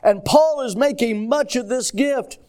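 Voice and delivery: high pulpit delivery, stretching the last word; full-force preaching. Real, not acted. Preacher warning the church about despising a gift, full-force preaching. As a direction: preach it hard and drag out the final word.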